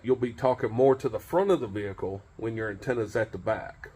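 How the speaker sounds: usual speaking voice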